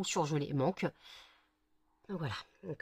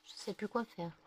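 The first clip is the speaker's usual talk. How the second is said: ordinary talk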